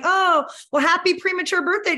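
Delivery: bright, oblivious well-wishing; chirpy mock greeting. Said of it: "I'm like".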